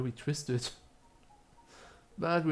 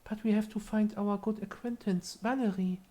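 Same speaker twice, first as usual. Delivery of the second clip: higher, formal seance voice; solemn character voice; 8 semitones higher than usual. Acted. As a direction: address the old spirit respectfully in character. Voices party member to spirit.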